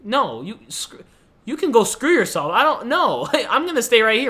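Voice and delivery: playful mock outrage at the cousin; mock angry retort. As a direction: snap it back at him, half laughing. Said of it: "I would have been pissed".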